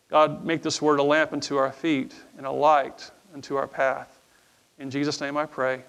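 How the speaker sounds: soft, low earnest prayer voice. Acted. Pastor prays over the congregation, soft and earnest. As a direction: pray it quietly to God.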